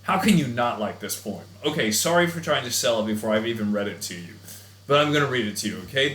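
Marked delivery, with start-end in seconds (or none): none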